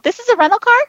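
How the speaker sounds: squeaky-high, unsure question; high-pitched questioning reply. Retold or imitated. Driver answering the officer who pulled them over, high questioning reply.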